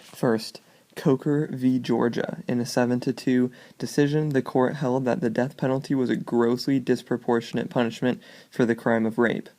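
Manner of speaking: usual speaking voice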